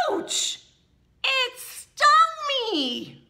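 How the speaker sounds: high, loud mock cry of pain; yelp, then stretch out the complaint; stung yelp. Acting out a bee sting.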